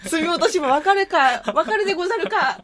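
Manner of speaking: hammed-up anime nerd; otaku voice. Playing otaku chat.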